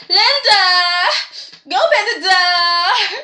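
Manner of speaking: mock holler from the couch; calling out. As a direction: yell for Linda to get the door. [name] calling out to Linda.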